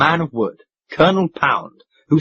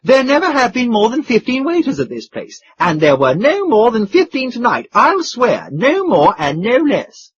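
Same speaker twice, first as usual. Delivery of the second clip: higher, eager sworn insistence; excited duke; 10 semitones higher, 3 dB louder than usual. Acted. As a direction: swear it eagerly. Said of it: "cried the duke excitedly".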